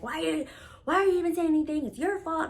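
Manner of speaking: fast, high, loud retort as the mom; mom's angry outburst. Retold or imitated. Mom turning her anger on her daughter, fast and loud. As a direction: fire it back fast, blaming her.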